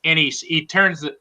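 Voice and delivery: ordinary delivery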